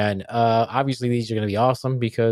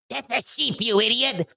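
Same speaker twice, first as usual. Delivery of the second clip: high-voiced wife; 12 semitones higher than usual. Wife's voice in joke.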